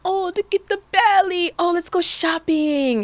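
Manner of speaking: high, sing-out cooing; gushing excitement. Retold or imitated. Imagining a supportive family at the baby bump, mock gushing. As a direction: coo it high, stretching the last words.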